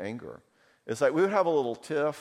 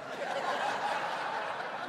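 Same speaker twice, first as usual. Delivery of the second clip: soft laugh, no voice; breathy laugh; 6 dB quieter than usual. Real, not acted. Speaker laughing at the crowd's reaction, quiet and unvoiced.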